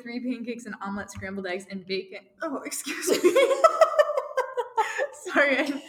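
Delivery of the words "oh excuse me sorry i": giggling apology; embarrassed laugh. Real, not acted. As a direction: laugh off a burp, a bit embarrassed. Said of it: "I just had a burp".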